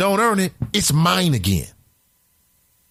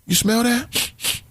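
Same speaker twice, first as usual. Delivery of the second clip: put-on kids smelling weakness; 4 semitones higher, slower than usual. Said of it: "If they sense weakness, like".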